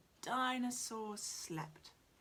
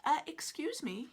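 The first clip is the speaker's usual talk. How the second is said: polite; 6 semitones higher, 4 dB louder than usual. Acted. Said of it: "Bill coughed politely".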